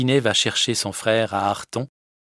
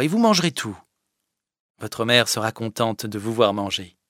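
higher, firm but caring orders; firm Fadette; 5 semitones higher, 4 dB louder than usual. Acted. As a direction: insist kindly that he eat. (la fadette, ferme et bienveillante)

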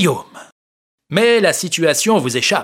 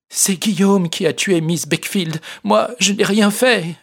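higher, pleading denial; mild protest; 7 semitones higher than usual. Acted. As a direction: insist she did nothing. Aurélie protests her innocence.

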